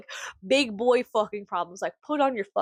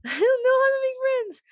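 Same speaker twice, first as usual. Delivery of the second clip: laughing; 14 semitones higher, faster than usual. Real, not acted. Laughing confession about friends.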